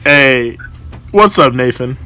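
ordinary delivery

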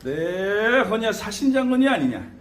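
shaman invocation. Shown as a demonstration. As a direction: proclaim each spirit general's name with authority. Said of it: "이렇게 얘기를 하고 얘기하면".

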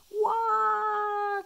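loud high crying wail; toddler wail. A narrator's reading. George bursts into tears over his broken toy, wailing. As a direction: wail like an upset little boy. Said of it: "cries George".

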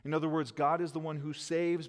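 ordinary delivery

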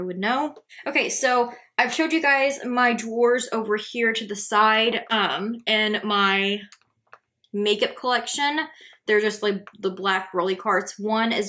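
muffled behind fingers; speaking through hand. Real, not acted. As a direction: hand covering lips. Seen 0: fingers pressed over lips throughout labelled words, sheet mask on.

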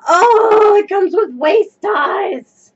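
excited cry. Real, not acted. Delighted cry over skirt.

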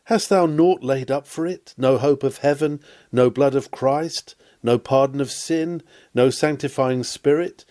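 usual speaking voice

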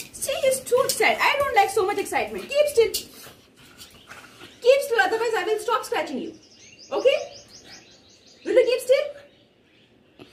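high-pitched mock-stern scolding. Real, not acted. Mother telling her excited dog to keep still, high mock-stern. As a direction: scold the pet firmly but playfully.

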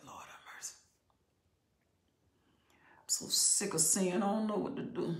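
breathy whisper, nearly silent; whispered lament. Real, not acted. Preacher grieving aloud to congregation, whispered. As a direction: let it slip out under your breath.

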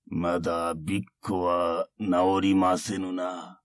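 put-on slow, soft taunting old monk; quiet mocking old monk; creaky wise old man.

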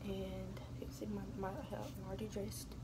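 soft unvoiced whisper throughout; whispered vlog. Real, not acted. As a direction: keep it hushed as if someone sleeps nearby. Keeps voice down while dressing.

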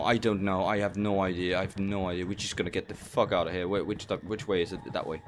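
ordinary talk

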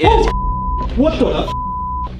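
high, sudden scream of fright; jolted by a shock, yelp it out; startled shriek. Real, not acted. Sudden fright at the spirit box.

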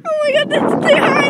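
high, loud scared cry; real fright at the height; scared squeal. Real, not acted. Kid on swing, scared of height.

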